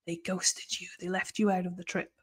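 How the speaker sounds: hushed, sympathetic aside to the speaker; hushed sympathy. Acted. Teacher hushing in sympathy for the man.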